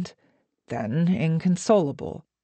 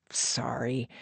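soft, lower aside; quiet apology; 6 semitones lower, 8 dB quieter than usual. A narrator's reading. Actor apologises to his friend once more, quiet and low. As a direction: say it under the voice.

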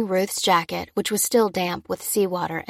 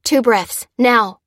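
urgent order; 4 semitones higher, 7 dB louder, slower than usual. A narrator's reading. Orders during CPR.

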